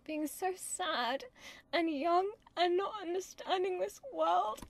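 high, slow mock-pitiful lilt; exaggerated woeful young Juliet; mock sad. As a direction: play up the poor young innocent. Mimics a sad, naive Juliet.

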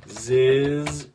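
very slow, stretched-out read; drawn-out deadpan reading. Pretend. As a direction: drag every word out in mock awe. Reading a silly website line.

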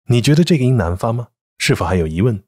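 ordinary delivery

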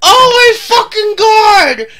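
loud, drawn-out horrified cry; panicked cry. Real, not acted. Streamer horrified by a spider, loud panicked cry. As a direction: shout it slowly in horror. Finds spider in picture frame.

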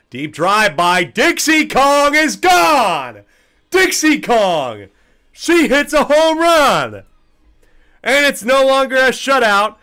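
high, loud, slow triumphant call; home run call. Acted.

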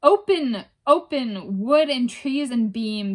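furious witches crying to the door; raging cry. Acted. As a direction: shout it at the barred door. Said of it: "they cried".

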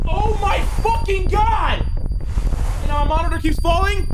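real high-pitched screaming freak-out; frantic gamer meltdown at full volume; panicked shouting. Real, not acted. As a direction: yell it out loud and high, in a total panic. Panicked outburst after losing mouse.

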